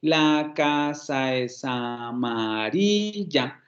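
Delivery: very slow, syllable by syllable; slow syllable-by-syllable demo; said slowly. Shown as a demonstration. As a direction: stretch out each syllable slowly. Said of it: "decir esta frase de manera lenta".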